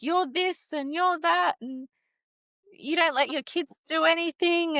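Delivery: a light parody of the father's rant; mimicked rant. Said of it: "he just went on a rant".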